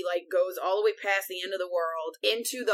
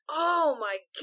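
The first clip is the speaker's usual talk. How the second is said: appalled exclamation; 8 semitones higher than usual. Retold or imitated. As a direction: react aghast, pitched well up. Reacting appalled to the killing.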